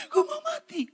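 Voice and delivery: squeaky, high mock whine of despair; hammed-up self-pity; mock melodrama. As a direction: mimic an overdramatic moaner. Mocking a dramatic churchgoer.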